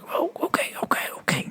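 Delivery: breathless whispered okay; awed whisper. Retold or imitated. Recruit reacting to the bus driver's speech, awed whisper. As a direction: whisper it, wide-eyed and impressed. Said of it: "you're like".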